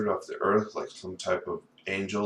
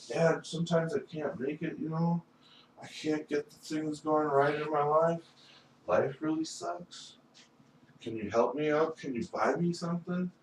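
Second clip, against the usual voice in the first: high, softer begging to dad; pitched-up, soft mock plea; acted pleading; 8 semitones higher, 4 dB quieter than usual. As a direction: play a son asking for help. Acts himself pleading with dad.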